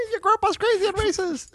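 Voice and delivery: put-on very high squeaky child voice; little girls' voices. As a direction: call her name like excited little girls. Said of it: "That's how second graders talk".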